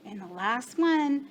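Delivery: ordinary talk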